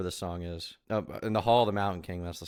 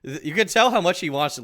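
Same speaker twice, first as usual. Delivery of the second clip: ordinary delivery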